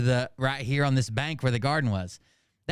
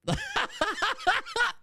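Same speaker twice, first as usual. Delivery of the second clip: real squealing laugh, much higher; high burst of laughter. Real, not acted. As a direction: break up laughing. Reacting to own joke.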